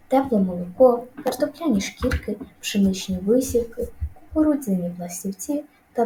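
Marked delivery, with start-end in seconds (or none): none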